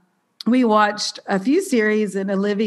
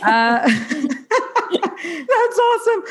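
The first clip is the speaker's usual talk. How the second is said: real laugh at her own slip, drawn out and much higher; 9 semitones higher, much slower than usual. Real, not acted. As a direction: laugh it off after stumbling.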